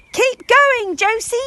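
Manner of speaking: high, loud cheering shout; shouted encouragement. Acted. Parents cheer Josie from the crowd, loud high shout. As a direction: yell her on from the crowd. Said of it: "Jimmy Jump shouted".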